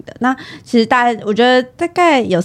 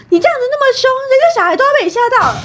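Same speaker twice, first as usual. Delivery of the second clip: accusing mother; 3 dB louder, much faster than usual. Retold or imitated.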